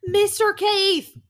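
quoting mom. Retold or imitated. Reprising mom's call.

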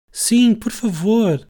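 high, slow begging plea; pleading. Acted. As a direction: beg for it sweetly and slowly. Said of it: "implorou Alice".

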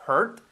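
isolated model "Hurt" with a breathed H; H aspirada. Shown as a demonstration. ("Hurt" con H aspirada, como modelo)

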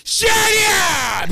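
high, loud, drawn-out shout; Adam Sandler impression. Comedian showing off an impression to friends, shouted impression. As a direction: yell it out in a comic impression. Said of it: "I do a little bit Adam Sandler".

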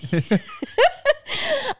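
long high laugh. Real, not acted. Laughing at the mask joke, high and long. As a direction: break up laughing.

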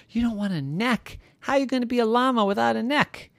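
high-pitched disbelief; incredulous old woman. Acted. Old woman hears llama's odd wish, incredulous. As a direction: question it in a high, amazed voice.

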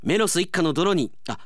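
usual speaking voice

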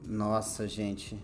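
low, quiet letdown; disappointed. Real, not acted. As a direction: sink in disappointment. Disappointed reaction.

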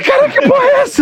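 very high, loud, laughing outburst; amazed shout. Retold or imitated. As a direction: shriek it in laughing disbelief. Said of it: "Eu falei". (grito espantado rindo)

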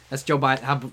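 normal talking voice

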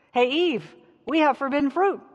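called out high and loud to Eve; excited calling out. Acted. Adam calling Eve over about the fruit, excited. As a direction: shout the news across to her, thrilled.

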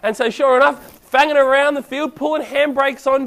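ordinary talk, nothing marked

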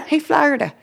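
high, sing-song hey; playful call. Pretend. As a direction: greet a buddy across the room. Calling out playfully.

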